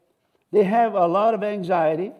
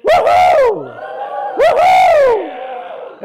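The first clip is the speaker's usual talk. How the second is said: high, loud whooping yell; joyful yell; 9 dB louder, much slower than usual. Real, not acted. Preacher yelling for joy after the declaration. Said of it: "just to go and yell a little bit".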